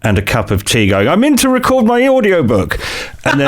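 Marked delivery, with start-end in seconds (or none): 1.1-3.5 mock chirpy. Mocking Instagram authors.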